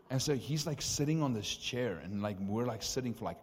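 ordinary delivery

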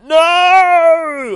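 very high, loud yell; dramatic scream. Acted. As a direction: scream as he falls. Doctor falls into the pit.

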